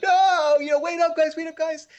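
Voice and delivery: mock whiny twelve-year-old; whiny kid. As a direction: beg the older boys to wait up. Kid left behind calls after them.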